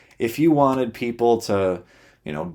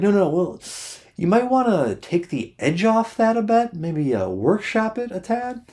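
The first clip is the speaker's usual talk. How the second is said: put-on much higher, gently cautioning girlfriend voice; imitating girlfriend; 10 semitones higher than usual. Girlfriend advising him to soften the joke, high mock voice. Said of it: "And she's like".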